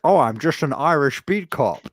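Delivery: hammed-up Connery accent, lifted pitch; mock Connery as cop. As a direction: play a cop with slushy esses. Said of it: "where he's just like".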